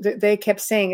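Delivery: ordinary delivery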